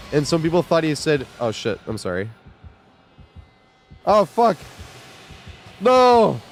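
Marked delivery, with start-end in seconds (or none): 4.0-6.4 high, loud shocked cry; startled outburst. Real, not acted. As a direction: yelp as it goes wrong. Sudden game mishap.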